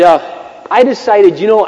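normal talking voice

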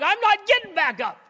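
high, loud whining refusal; hammed-up tearful yell; mock tantrum. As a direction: act out a crying sulker's protest. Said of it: "crying, like".

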